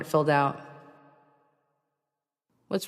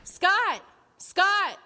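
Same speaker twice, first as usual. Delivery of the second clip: high, carried call down the hall. Acted. Ava calling through the house for Scott, high call. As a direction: call his name across the house.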